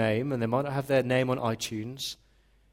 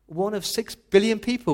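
ordinary talk

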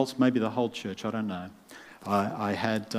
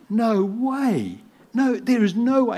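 mock refusal; 13 semitones higher, 8 dB louder, slower than usual. Coworker refuses to buy coffee.

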